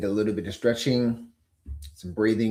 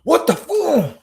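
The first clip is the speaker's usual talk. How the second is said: high, loud mock disbelief; mock shock; 7 dB louder, slower than usual. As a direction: blurt it like looking back at a bad trade. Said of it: "You look back and say".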